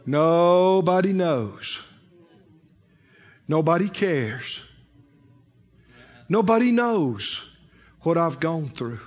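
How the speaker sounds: slow, low, drooping lament; mock self-pity. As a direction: imitate someone wallowing in depression. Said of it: "to depression".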